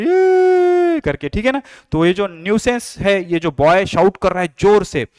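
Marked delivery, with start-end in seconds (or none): 0.0-1.0 sudden high, loud squeal; mock child shriek. Teacher to class, imitating a child yelling in a theatre, high mock shriek. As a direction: shriek it like a kid in a cinema. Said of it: "उसमें ये चिल्ला रहे".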